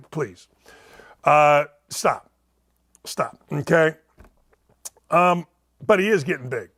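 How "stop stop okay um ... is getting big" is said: usual speaking voice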